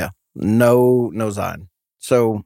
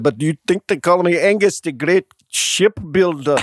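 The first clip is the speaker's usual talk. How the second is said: put-on higher, Scottish accent slipping into Transylvanian; botched Scottish accent; 5 semitones higher than usual. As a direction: attempt an indignant Scotsman, sounding like Dracula. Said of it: "you're from Transylvania?".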